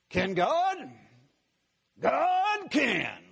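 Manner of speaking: Can God rising, then God can soaring high and loud; dramatic pause, then a high ringing God can; impassioned old preacher. Retold or imitated. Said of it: "I remember how he would preach".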